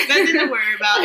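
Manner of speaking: laughing through it, fast and loud. Real, not acted. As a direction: giggle the words out quickly. Laughing off the coughing fit.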